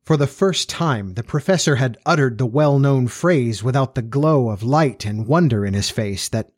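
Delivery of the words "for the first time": usual speaking voice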